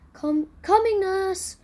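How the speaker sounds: high loud call from down the hall; far-off call. A narrator's reading. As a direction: shout back from a distance. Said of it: "came a voice from far off".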